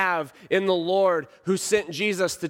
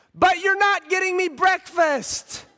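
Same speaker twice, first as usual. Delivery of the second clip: put-on very high, loud kid's wail; upset child; 5 dB louder than usual. Son objecting to dad about breakfast, loud high-pitched protest. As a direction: mimic a distressed child protesting at the top of the range. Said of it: "he's crying now".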